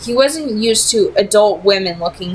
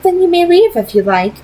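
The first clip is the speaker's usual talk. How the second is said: put-on higher, poised vampire lady with a faint accent; Camille; 10 semitones higher, 5 dB louder than usual. A narrator's reading. Vampire leader tempting Simon with an offer, poised and higher. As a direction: speak as a cool, persuasive vampire leader.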